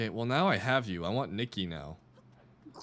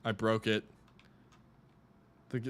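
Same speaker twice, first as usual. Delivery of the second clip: ordinary delivery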